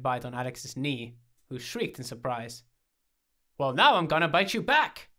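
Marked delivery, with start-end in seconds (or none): 3.6-5.1 loud, high playful threat; mock battle cry at a puppy; raised-voice playful revenge; declaring war. A narrator's reading. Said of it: "Alex declared war".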